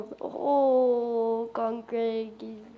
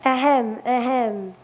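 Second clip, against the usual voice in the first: throat clearing; 5 dB louder than usual. Acted. Calling room to attention.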